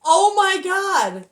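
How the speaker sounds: slow, loud, drawn-out oh my God; overwhelmed. Real, not acted. Reader reacts to the Ten of Pentacles, awed and loud. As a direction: let the awe pour out. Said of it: "I'm so hyped and happy".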